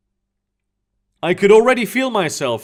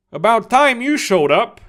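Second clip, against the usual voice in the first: teasing, light jab; somewhat jokingly; 6 semitones higher than usual. A narrator's reading. Kalabasi teasing his late soldiers jokingly. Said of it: "somewhat jokingly".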